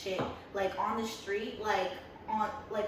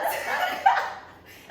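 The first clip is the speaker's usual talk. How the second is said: high breathy laughter; squealing laugh; 5 dB louder than usual. Real, not acted. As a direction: burst out in a squeal. Laughing at own quote.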